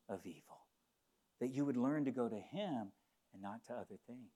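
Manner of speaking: tender hushed sermon; hushed preaching. Real, not acted. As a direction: draw them in, nearly hushed. Preacher drops to intimate tone.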